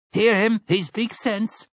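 high, slow call from the crowd. A narrator's reading. Storekeeper backing the objector from the crowd, calling out. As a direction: call out in support, pitched well up. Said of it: "called out".

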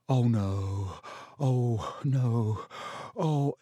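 breathy moaning, climbing in panic; rising dread. A narrator's reading. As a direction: moan it over and over, frightened. Ron dreading the approaching creature.

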